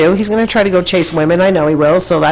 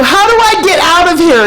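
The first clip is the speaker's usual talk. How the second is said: exasperated question; 11 semitones higher, 4 dB louder than usual. Retold or imitated. Asking ticket lady, exasperated.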